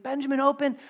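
urgent dad. Acted. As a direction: call through a door as a worried father. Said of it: "he's like, he's like".

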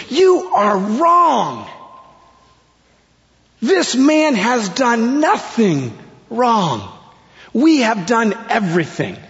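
high, slow, emphatic yell; forceful rebuke. Retold or imitated. Second thief rebuking the other thief, yelling slowly. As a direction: drive each word home at high pitch. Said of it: "He rebukes him".

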